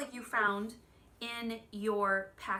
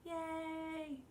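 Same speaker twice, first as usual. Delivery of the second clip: first yay soaring, second one softer; cheering yay; 6 semitones higher, 4 dB quieter than usual. Shown as a demonstration. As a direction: cheer like a happy kid clapping. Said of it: "clap your hands and say".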